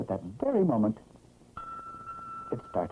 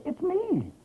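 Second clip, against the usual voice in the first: very high squeaky chick voice; chick's high beeping. A narrator's reading. Newborn chick announcing himself, squeaky high. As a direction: peep it out like a tiny chick. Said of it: "And suddenly he said".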